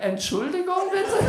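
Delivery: high, questioning German lady voice; German woman. Retold or imitated. Dog owner turning to the staring comedian, high and puzzled.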